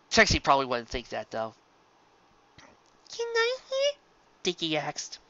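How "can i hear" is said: bright, small high-pitched filly asking. Acted. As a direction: ask like an eager little filly. Filly voice in the story.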